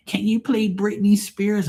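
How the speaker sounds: high, drawn-out mock girly plea; mock requester. Drunk passenger begging the DJ for [name], mocking high voice. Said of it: "nasty hoods coming there talking about".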